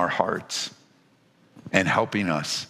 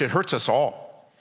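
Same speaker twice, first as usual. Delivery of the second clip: ordinary talk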